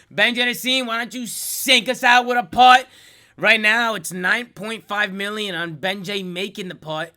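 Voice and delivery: loud, high, excited sports call; hype announcer. Acted. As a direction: pump up the crowd like a broadcaster. Hyping a putt like a broadcaster.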